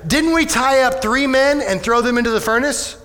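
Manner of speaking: high, excited disbelief; king amazed at the furnace; amazed exclaim. Retold or imitated. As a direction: blurt it out in amazement. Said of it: "jumped up in amazement and exclaimed".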